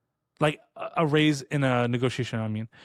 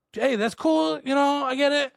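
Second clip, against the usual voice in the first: very high, over-agreeable mock cheer; high-pitched put-on breeziness; fake nonchalance; 11 semitones higher than usual. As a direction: imitate yourself pretending it's fine, pitched way up. Retold put-on reply to bad news.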